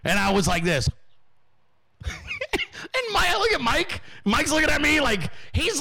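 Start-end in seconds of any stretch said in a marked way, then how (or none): 2.0-2.9 a sudden high, soft laugh; high burst of laughter. Real, not acted. Hearing his show plays at the office, high laugh. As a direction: laugh in delighted disbelief.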